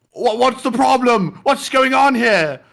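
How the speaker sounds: high, loud, drawn-out angry shout; Gordon Ramsay. Retold or imitated. Chef breaking up a kitchen fight, mock angry shouting. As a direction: bellow like an irate chef, slow and high. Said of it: "Gordon Ramsay was like".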